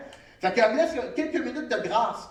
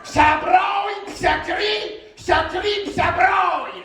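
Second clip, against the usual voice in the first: shout; 7 semitones higher, 6 dB louder than usual. Acted.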